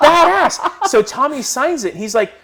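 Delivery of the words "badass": high, loud cheer with a laugh in it; excited laugh. Retold or imitated. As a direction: whoop it through a laugh. He reacts to Tommy.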